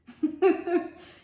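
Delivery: sudden high laugh; high giggle. Real, not acted. Reader laughing at the kiwi and crocodile combo. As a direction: burst out giggling.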